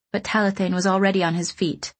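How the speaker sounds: usual speaking voice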